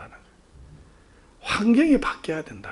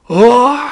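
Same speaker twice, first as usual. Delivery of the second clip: loud bawling cry, re-enacted; imitated loud wail; 5 semitones higher, 13 dB louder, much slower than usual. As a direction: burst out wailing, acted. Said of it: "엉엉 울었어요". (엉엉 우는 울음 흉내)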